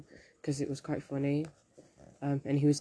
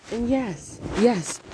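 high, loud admission; defensive exclamation; 8 semitones higher, 6 dB louder, much slower than usual. Real, not acted. Confessing to viewers about having Yubo, defensive exclamation. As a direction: burst out high and loud, owning up.

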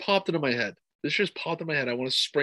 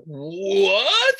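high, loud, rising what; shocked what; 5 semitones higher, 6 dB louder than usual. Retold or imitated. Co-host recalling his reaction to the takedown stop, surprised. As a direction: disbelief at the fighter's move. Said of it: "and I was like".

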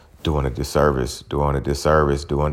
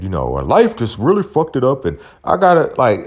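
higher, hesitant mock whine of the broke boyfriend; whiny excuse; 6 semitones higher than usual. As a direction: stammer out the excuse like a sheepish son.

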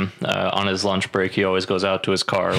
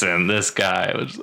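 ordinary talk, nothing marked

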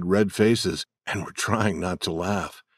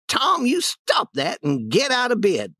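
put-on stern old lady; 13 semitones higher than usual. Aunt scolding Tom.

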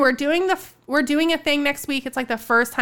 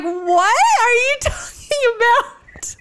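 laughing confused quote; 11 semitones higher, slower than usual. Retold or imitated. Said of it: "I was like".

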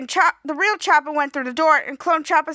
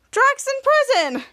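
excited; 8 semitones higher, 4 dB louder, much slower than usual. Retold or imitated. Excited character quote.

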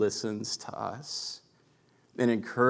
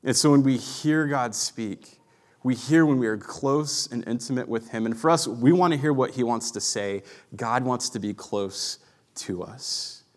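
ordinary delivery

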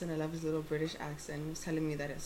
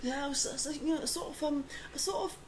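put-on light British accent, higher; a hint of a gentle Englishman; 9 semitones higher than usual. Retelling an old British man describing his flu, light British accent. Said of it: "his little accent".

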